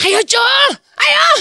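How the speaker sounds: high, urgent call to hurry him up; impatient call. Retold or imitated. Wife calls her husband out of the crowd, high and urgent. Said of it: "saya cuma gini".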